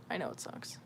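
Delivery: ordinary delivery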